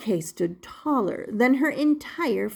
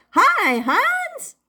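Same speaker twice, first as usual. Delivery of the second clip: much higher, loud call; 14 semitones higher, 6 dB louder than usual. Acted. Puppet calling to the baker from the doorway, loud. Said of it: "Punchinello called".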